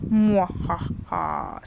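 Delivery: slow evil mwahaha; villainous drawn-out cackle; psychopath laugh. Acted. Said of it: "Psychopath laugh".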